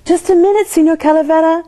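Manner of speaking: high, bright call to stop him. A narrator's reading. Grandma calling Signor Calavera back, a high call.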